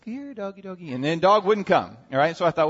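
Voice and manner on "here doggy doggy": soft, higher-pitched call to a dog; sweet, hushed doggy-calling voice; coaxing nicely. Retold or imitated. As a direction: gently coax a wary little dog. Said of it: "real nice".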